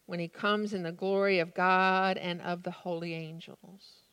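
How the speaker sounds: ordinary talk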